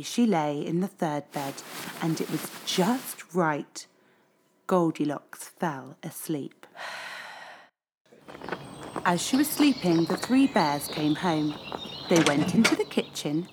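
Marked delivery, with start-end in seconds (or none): none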